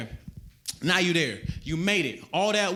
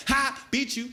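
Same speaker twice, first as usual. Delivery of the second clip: put-on gloating kid; 9 semitones higher, slower than usual. Comic as a kid at the top of the stairs, high gloating taunt. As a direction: crow like a kid winning a race. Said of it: "like that kid".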